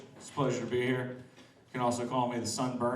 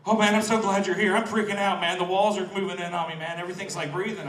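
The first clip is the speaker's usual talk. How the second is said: tripping friend panicking; 7 semitones higher, 7 dB louder than usual. Retold or imitated. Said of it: "They're curled up in the corner".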